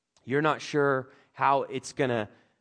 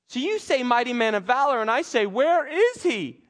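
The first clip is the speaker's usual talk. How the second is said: incredulous Gideon; 9 semitones higher, 6 dB louder than usual. Acted. Gideon doubting the title God gave him, high and loud. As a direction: pitch up and stretch out the disbelieving question. Gideon disbelieving God's praise.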